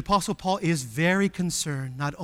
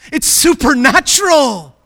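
loud, raised pulpit cry; exultant preacher's shout; emphatic proclamation; 8 semitones higher, 16 dB louder, slower than usual. Real, not acted. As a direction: proclaim it high and strong. Climax of the preacher's point.

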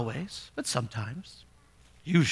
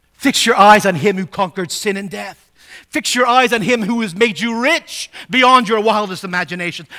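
louder, higher, urgent pulpit voice; preaching at full force; 5 semitones higher, 10 dB louder than usual. Acted. Preacher urging the poor congregation, louder and higher. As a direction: preach it out to the whole church. Exhorting congregation to fix eyes.

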